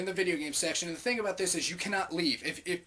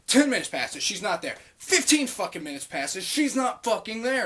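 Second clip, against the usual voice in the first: mounting exasperation, getting higher and louder; retold fuming; 4 semitones higher, 6 dB louder than usual. Clerk waiting for the mop bucket, retold fuming. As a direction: build the acted annoyance with each phrase.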